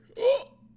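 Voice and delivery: sharp high gasp of surprise; startled gasp. Real, not acted. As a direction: gasp as if suddenly falling. Suddenly falls in game.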